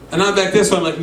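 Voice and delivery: ordinary talk, nothing marked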